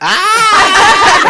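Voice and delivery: sudden high shriek of laughter; laughing scream. Real, not acted. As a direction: burst into loud, high laughter. Caught off guard, bursts out laughing.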